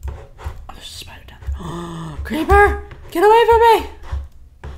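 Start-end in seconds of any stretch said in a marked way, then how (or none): 2.2-4.7 panicked shout, much louder; screaming at a creeper. Real, not acted. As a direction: yell in a panic.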